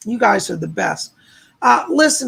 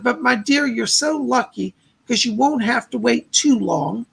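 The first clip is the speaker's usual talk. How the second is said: usual speaking voice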